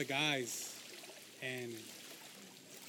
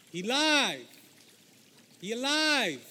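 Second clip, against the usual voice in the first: loud, higher joyful shout of the news; excited yell; 6 semitones higher, 8 dB louder, slower than usual. Retold or imitated. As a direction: cry it out as she runs up. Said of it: "Mary is in a full sprint toward us screaming".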